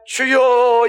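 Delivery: high, loud call to the Lord; loud, sustained prayer call; ordinary prayer cry. Shown as a demonstration. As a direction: call out in prayer, short of a scream. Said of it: "이런 게 아니라".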